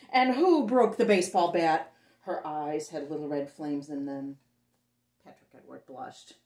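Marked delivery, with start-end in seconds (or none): 0.1-1.9 loud, furious demand; mother shouting at the boys over a broken bat; angry shout. Acted. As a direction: bellow it at the guilty boys.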